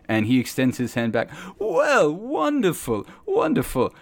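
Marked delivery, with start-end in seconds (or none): 1.6-3.9 high, eager wonderful; overjoyed robed preacher shaking hands; delighted. Acted. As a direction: gush over a first visitor. Delighted preacher greets player.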